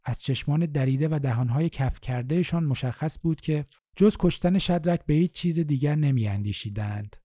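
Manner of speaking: plain reading voice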